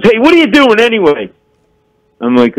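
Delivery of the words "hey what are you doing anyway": put-on heckling drunk. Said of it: "and he goes".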